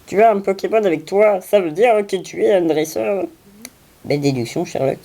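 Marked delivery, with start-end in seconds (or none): 0.1-3.2 put-on kid's voice, pitched up and eager; voix de gamin. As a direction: play an excited little trainer. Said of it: "j'adore son dialogue". (voix de gamin enthousiaste)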